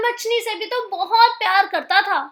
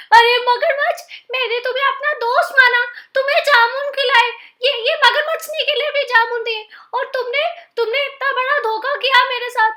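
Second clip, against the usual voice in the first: higher, fast hurt accusation; rushed, raised, wounded reproach; betrayed outrage; 7 semitones higher, faster than usual. Acted. Monkey scolding his crocodile friend for betrayal, raised and hurt.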